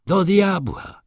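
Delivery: loud, high curse slammed out; emphatic exclamation; angry outburst. A narrator's reading. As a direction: burst out as the glass hits the table. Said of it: "Zakończyłem, uderzając szklanką w stół".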